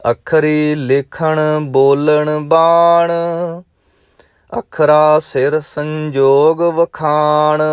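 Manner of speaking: slow, measured chanting recitation; gurbani recitation. Acted. Teacher reciting Japji Sahib to students, measured chant. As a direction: intone each line with devotion.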